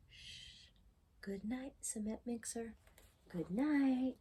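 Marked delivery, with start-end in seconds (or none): 0.1-0.7 breathy hush with no voice; whispered shush. Acted. Reader hushing the listener, unvoiced shush. Hushing before good night. 1.2-2.7 unvoiced, hushed goodnight; whispered goodnight. Reader bidding cement mixer goodnight, in a whisper.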